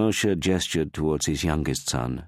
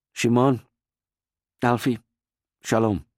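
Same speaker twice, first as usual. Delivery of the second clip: louder, higher called goodbye; calling out farewell; 8 semitones higher, 4 dB louder than usual. Acted. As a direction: call across the room to them. Calling farewell across doorway.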